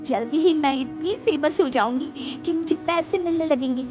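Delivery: high, loud daydreaming bahu; excited boasting. Acted. Daughter-in-law telling mother-in-law about coming fame, excited.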